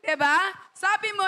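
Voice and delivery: ordinary talk, nothing marked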